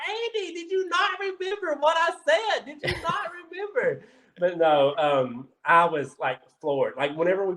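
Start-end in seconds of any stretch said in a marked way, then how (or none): 0.1-4.0 much higher, softer, laughing disbelief; retold shocked reproach, pitched way up through a laugh; exasperated squeal. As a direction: squeal at your friend in laughing despair. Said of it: "I'm like".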